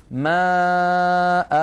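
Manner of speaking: ماء held very slowly, the final a long; very slow, held "maa'aa"; pausal madd. Shown as a demonstration. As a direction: stretch out ماء, both long vowels drawn. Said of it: "هذا المثال الثالث".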